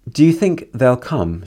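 slow, hesitant question with a lift; doubtful. A narrator's reading. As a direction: ask it unsure the guests will ever come. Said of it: "she says doubtfully".

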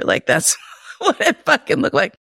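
words broken by a high giggle; real amused laugh mid-sentence; laughing through it. Real, not acted. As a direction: giggle as you say it. Laughing while describing his face.

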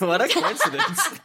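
high laughing repeat; laughing echo. Real, not acted. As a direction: laugh through the words.

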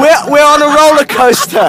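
loud, high shouting over roller coaster noise; shouting over the ride. Real, not acted. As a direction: yell every word over the wind. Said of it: "You can hear me screaming for my life".

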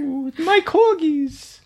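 very high, slow prim English lady voice; posh British lady. Pretend. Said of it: "You can dance like a British lady".

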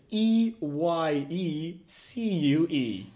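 letters spelt out slowly; slow spelling out. Shown as a demonstration. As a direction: spell each letter deliberately. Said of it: "spelling the name".

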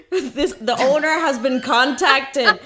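loud, slow mock Northern Irish alarm announcement; terrible Northern Irish accent. Imitating the security alarm across the street in a hammed-up loud, slow Northern Irish accent. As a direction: boom it out slowly like a robotic Northern Irish security warning. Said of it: "'cause that's a terrible accent".

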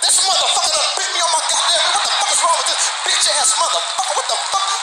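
high-pitched mock furious outburst; outraged cussing. Imitating Holyfield cussing after being bitten, mock outrage. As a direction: curse in shocked comic outrage. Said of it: "He cussed when that motherfucker bit him".

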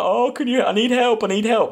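high, pleading cry for help; a high, panicked horseman begging for help; desperate plea for help. Retold or imitated. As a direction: beg for help like someone in pain. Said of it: "this person be like".

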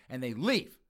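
ordinary delivery

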